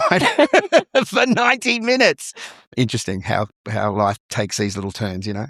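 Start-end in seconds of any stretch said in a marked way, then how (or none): 0.0-2.3 high-pitched, laughing through the words. Real, not acted. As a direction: crack up while finishing the sentence. Laughing at screaming at robots nightly.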